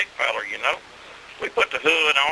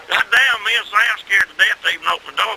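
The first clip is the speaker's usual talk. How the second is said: normal talking voice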